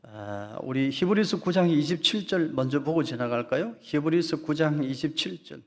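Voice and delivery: usual speaking voice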